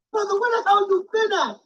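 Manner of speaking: ordinary delivery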